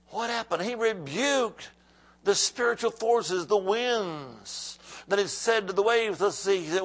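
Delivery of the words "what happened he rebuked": ordinary talk, nothing marked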